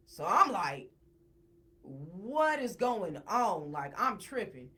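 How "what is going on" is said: slow, stretched-out disbelief; bewildered demand. Retold or imitated. Sees the two of them doing this movement, slow retold bewildered demand. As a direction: draw each word right out, re-enacting bewilderment.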